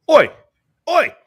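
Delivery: loud shout across the courtyard; calling out oi. Retold or imitated. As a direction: yell it to a stranger. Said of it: "Just like that too".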